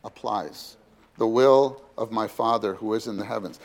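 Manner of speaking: ordinary talk, nothing marked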